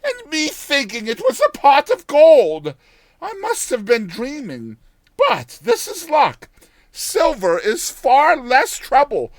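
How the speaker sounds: put-on high, loud old village woman; old countrywoman. Old woman cheerfully reconsidering.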